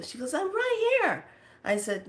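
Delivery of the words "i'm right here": very high little imitated voice; youngster calling back. Young one answering in smoky room, high calling voice. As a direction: pipe up from across the room, pitched way up.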